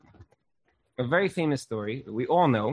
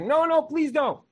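much higher, urgent begging no; pleading; 11 semitones higher than usual. Retold or imitated. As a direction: plead with him not to do it. Avram begging Hashem to spare.

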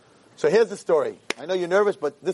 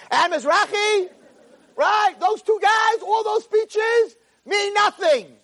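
very high, drawn-out shout; shouted protest; 3 dB louder, much slower than usual. Acted. Girl complains to Hashem about the rabbis' speeches, shouted protest. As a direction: hurl each phrase upward in protest.

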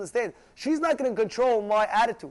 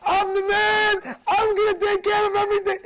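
blustering yell, far above normal pitch; shouting boast; 11 semitones higher, 6 dB louder than usual. Acted. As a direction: yell it like someone puffed up. Acted quote of indignant person.

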